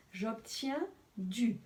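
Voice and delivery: normal talking voice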